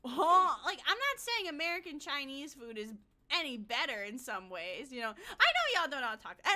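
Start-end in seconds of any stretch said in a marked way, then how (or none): 0.0-0.5 loud disbelieving huh, huh; bewildered, louder on each huh; incredulous huh. Retold or imitated. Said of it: "I'm like".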